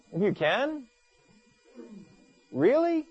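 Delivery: high, disbelieving Really; Jesus questioning the man's doubt; incredulous. Retold or imitated. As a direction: scoff at the doubt. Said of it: "That's how we would say it nowadays".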